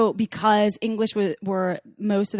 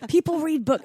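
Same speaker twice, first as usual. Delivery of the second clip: put-on higher, half-managed Scottish accent; attempted Scottish accent; 8 semitones higher than usual. Imitating the guy she hooked up with, attempted Scottish accent. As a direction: try a Scottish scolding, not quite landing it. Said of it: "I can't even do the accent right now".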